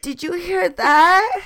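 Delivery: put-on high, slow, scared little girl squeak; squeaky frightened little girl. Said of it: "that little girl farting. She's like".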